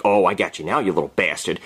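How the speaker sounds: low, gloating threat; mock villain's low growl; menacing gloat. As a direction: drop low and relish catching him. Acted gloating threat to fallen kid.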